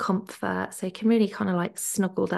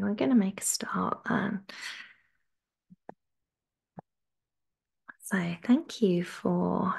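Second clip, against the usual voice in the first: whisper; 4 dB quieter than usual. Real, not acted. Opening the session quietly.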